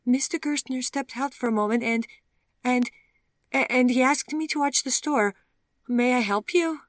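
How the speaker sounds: put-on high, loud, stammering young woman; nervous Vivian. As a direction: trip over the words, high and anxious.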